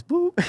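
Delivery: very high short boop; imitated call chime. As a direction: pop a high electronic-style boop. Imitates dad joining the call.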